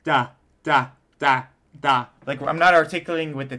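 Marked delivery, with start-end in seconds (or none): 0.0-2.1 louder, separate "Da" syllables; anchor tonguing. Shown as a demonstration. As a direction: tap each "Da" with the flat of the tongue. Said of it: "tip of the tongue is anchored".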